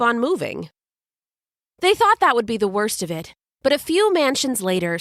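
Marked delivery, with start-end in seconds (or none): none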